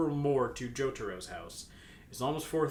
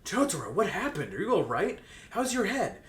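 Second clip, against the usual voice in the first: worried questions; 6 semitones higher, 5 dB louder than usual. Acted. Worried friend questioning sick Jotaro.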